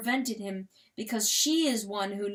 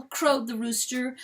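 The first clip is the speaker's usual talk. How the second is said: ordinary delivery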